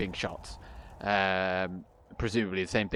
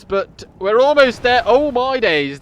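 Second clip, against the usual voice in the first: excited; 10 semitones higher, 9 dB louder than usual. Real, not acted. Units skewered by ballista.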